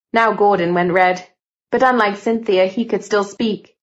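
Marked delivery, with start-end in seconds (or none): none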